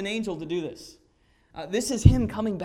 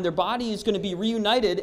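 ordinary talk

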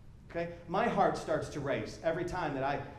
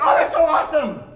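higher and much louder, bursting with delight; thrilled gush; 7 semitones higher, 12 dB louder than usual. Retold or imitated. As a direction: gush like a brand-new believer. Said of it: "how excited you were".

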